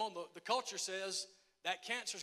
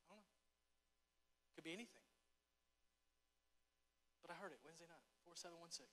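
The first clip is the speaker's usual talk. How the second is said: hushed prophetic call; 3 semitones lower, 11 dB quieter, faster than usual. Acted. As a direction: hold the room in a near hush. Soft, drawn-out prophetic number call.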